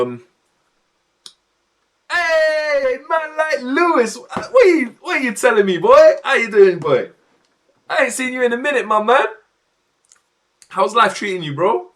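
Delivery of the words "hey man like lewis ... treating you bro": high, loud, hyped welcome; excited greeting. Real, not acted. Streamer greets an old gaming friend back, real excitement. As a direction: hail a long-lost friend with glee.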